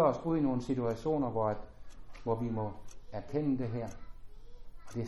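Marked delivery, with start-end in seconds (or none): none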